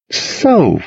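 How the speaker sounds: high, loaded, falling "So"; pointed. A narrator's reading. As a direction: open with a knowing, probing word. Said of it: "he said pointedly".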